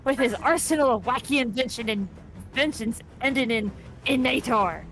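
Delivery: hammed-up pirate captain announcing a brawl; terrible pirate accent. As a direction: put on a rolling pirate accent like a fight announcer. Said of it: "forgive me for my terrible pirate accent".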